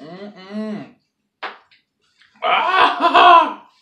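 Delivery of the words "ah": high cry breaking into laughter; laughing pained cry. Real, not acted. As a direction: yelp and laugh through sourness. Sour candy pain.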